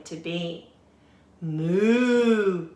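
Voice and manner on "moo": loud mooing cow. A narrator's reading. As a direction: moo it out long. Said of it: "said the cow".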